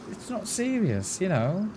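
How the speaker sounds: usual speaking voice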